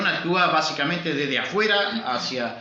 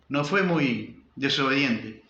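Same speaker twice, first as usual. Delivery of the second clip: ordinary talk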